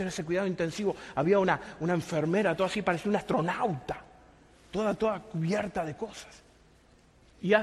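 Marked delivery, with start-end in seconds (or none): none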